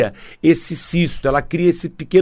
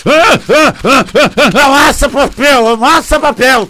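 high, loud cries while crumpling paper; venting by crushing paper; frustrated shouting; 9 semitones higher, 7 dB louder than usual. Shown as a demonstration. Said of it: "vou amassar o papel".

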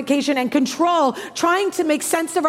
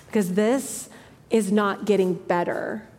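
ordinary delivery

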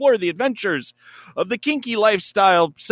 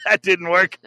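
usual speaking voice

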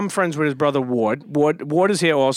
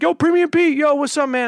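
calling out greeting; 10 semitones higher than usual. Retold or imitated. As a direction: shout a happy hello from the crowd. People greeting him loudly.

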